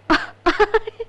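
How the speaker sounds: bright loud laugh; laughter. Acted. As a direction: burst out laughing. Woman laughs off request.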